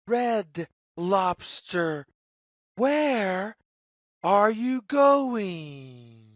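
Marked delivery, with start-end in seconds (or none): none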